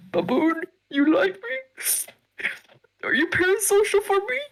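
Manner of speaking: very high, teasing falsetto; high squeaky voice. Pretend.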